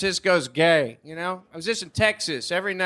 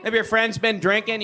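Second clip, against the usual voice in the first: ordinary talk, nothing marked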